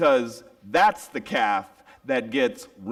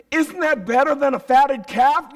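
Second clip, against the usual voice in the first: tender reassurance; 6 dB louder than usual. Acted. Father comforting the resentful son, tender. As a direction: reassure him with love.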